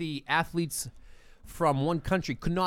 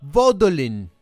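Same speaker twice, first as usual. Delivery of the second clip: fake Russian accent; 5 semitones higher, 4 dB louder than usual. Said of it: "What accent is this, Mike?".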